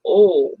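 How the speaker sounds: "Oh." with a long rounded northern O. Retold or imitated. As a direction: stretch "Oh." like an upper Midwesterner. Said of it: "his O's are very pronounced".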